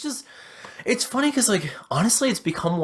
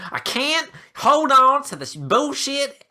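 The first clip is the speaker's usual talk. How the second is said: higher, louder and slow, hammed-up despair; mock exasperated outburst; 10 semitones higher, 5 dB louder, slower than usual. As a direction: throw up your hands in fake exasperation. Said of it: "what is that voice after every single week".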